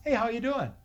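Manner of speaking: mock cheerful greeting. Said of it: "I'll show you what grumbling looks like".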